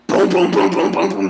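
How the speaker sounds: loud booming punch noises; loud imitated boom boom hits; mock punch sound effects. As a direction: punch each boom out loud like blows landing.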